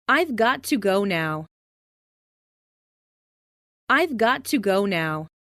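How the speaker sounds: normal talking voice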